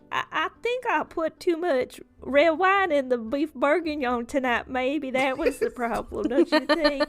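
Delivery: Felicity. Acted. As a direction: call it out to the room, high and loud. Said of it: "you just hear, like, Felicity".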